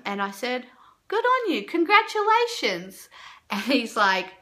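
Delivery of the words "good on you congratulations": high, mock-cheery congratulations; sarcastic. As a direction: sarcastically praise the heckler. Sarcastic reply to heckler.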